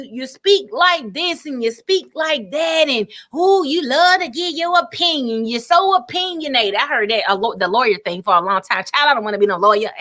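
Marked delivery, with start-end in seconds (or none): 0.0-6.7 high-pitched imitation of opinionated gossips; mock chit-chat. Imitating people commenting on how she talks, mock chit-chat. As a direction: stretch it out high, mimicking nosy commenters. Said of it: "they just begin to chit chat".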